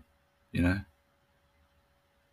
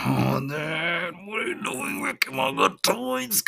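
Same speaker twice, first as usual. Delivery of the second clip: much higher mock-whiny little boy; whiny son flavor; 12 semitones higher than usual. Dad imitating his other son whining with a much higher voice. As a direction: pitch way up like a pleading kid. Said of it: "he only gets one of two flavors".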